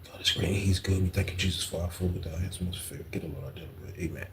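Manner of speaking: murmured prayer, fading right down; a hushed mock grace said fast under the breath; whispered grace. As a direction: pray under your breath to yourself. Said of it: "I said my graces in my head".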